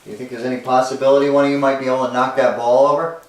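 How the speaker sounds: normal talking voice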